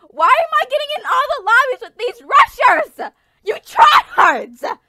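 annoyed gamer yell; indignant rant. Real, not acted. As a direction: shout at the sweaty players. Rants at rushers.

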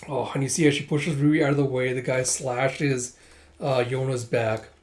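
plain reading voice

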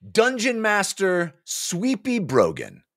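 slow, deliberate story reading; measured audiobook narration. A narrator's reading. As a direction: set the scene slowly for listeners. Narrator opens staged reading slowly.

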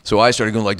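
normal talking voice

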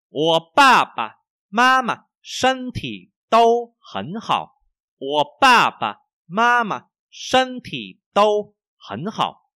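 slow, loud, high-pitched tone drill; language teacher model reading. Shown as a demonstration. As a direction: exaggerate each tone for learners. Models sentence for learners. (đọc mẫu chậm, cao và rõ)

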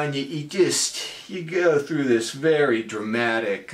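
ordinary talk, nothing marked

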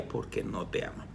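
a hushed, confiding verdict; dramatic whisper. Acted. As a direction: drop to a whisper to land the blow. Dramatic punchline to a listed sign.

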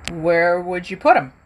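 plain reading voice

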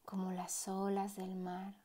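unhurried meditation voice with long pauses; guided meditation. Acted. Meditation guide easing a listener into relaxed breathing, guided meditation voice. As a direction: guide the listener gently, letting each phrase settle.

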